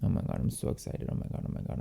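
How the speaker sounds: breathless, whispered oh my Gods; excited whisper. Real, not acted. Thrilled by the coffee perfume, she whispers excitedly. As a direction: whisper your excitement fast.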